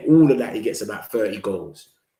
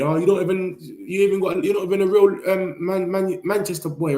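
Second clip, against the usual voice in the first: high mocking rival-fan voice, raised; higher sneering taunt; 8 semitones higher than usual. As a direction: taunt him like a smug Arsenal fan. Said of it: "people will tell me".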